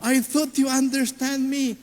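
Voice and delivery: imitating a sulky teen; hurt teen. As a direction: sound like a hurt child.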